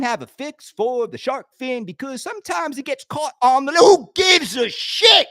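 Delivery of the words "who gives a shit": slow, loud, drawn-out exasperation; loud, slowed-down who-cares outburst; exasperated outburst. Real, not acted. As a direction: throw it out like you're done caring. Fed-up outburst about the drama.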